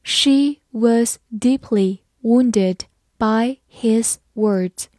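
word-by-word slow teacher reading; slow dictation-like model sentence; slow read-aloud. Shown as a demonstration. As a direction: pace it out slowly, a gap after each word. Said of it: "好，慢慢来读".

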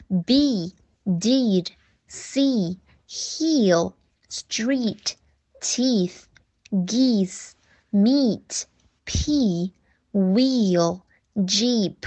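word list with long tense ee, each word apart; =free careful, separated long-vowel reading; long E drill. Shown as a demonstration. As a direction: model each word with the ee held. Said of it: "也是发长音E的音".

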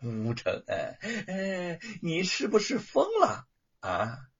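high, mocking sneer rising to a loud threat; bully him, then threaten; taunting young master. A narrator's reading. Spoiled young master taunting Wu, sneering threat.

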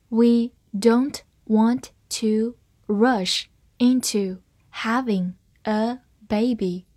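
slow, each word firmly set apart; slow reading. Shown as a demonstration. Teacher models the sentence for learners, slow reading. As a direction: read it word by word, unhurried. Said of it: "我们慢读一遍".

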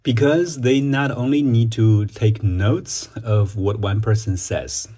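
slow, careful reading of an exam answer; model answer reading. Shown as a demonstration. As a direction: read it slowly, word by word, for students. Reads model answer sentence. (慢速示范朗读)

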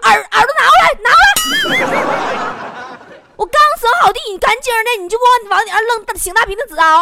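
loud, high tirade; hammed-up angry scolding. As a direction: yell as if dragging someone by the ear. Acted scolding, grabbing his ear. (夸张地高声揪耳朵训人)

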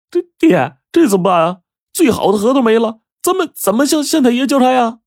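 a son, frightened, cries to his father for help; panicked plea. Acted. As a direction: beg your father in a panic. Son panicking over lost walnuts.

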